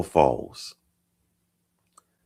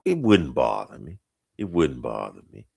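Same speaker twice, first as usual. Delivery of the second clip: falling to a low, softer voice; put-on deep voice; 6 semitones lower, 6 dB quieter than usual. Host correcting his answer about harsh voices, deep. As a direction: drop down deep and ease off.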